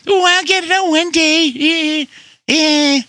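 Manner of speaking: high, nasal mock of the repair guy's excuse; mocking whiny voice. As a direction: whine it like a lazy excuse-maker. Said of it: "the Russian there in Poco".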